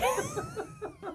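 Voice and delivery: squeaky breathless giggle; high squealing laugh. Real, not acted. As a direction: burst out in a thin high laugh. Breaks up after the skit.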